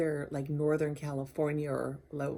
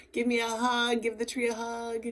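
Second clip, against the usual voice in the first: a higher, lightly sung-out greeting to the tree; playful greeting to a tree; 6 semitones higher than usual. Pretend. Visitor greeting the memorial tree, light and playful. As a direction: coax the tree into a hug, playfully. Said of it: "and just say".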